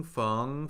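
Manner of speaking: "feng" rising steadily; second tone. Shown as a demonstration. Teacher cycling four tones on a syllable, "feng" rising. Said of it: "Let's do the four tones".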